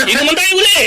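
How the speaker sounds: high, laughing call for another round; giddy drunk shout; tipsy cheer. Acted. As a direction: raise a toast, half drunk.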